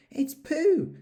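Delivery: put-on boy spotting his friend; excitedly. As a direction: burst out with delight. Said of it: "said Christopher Robin excitedly".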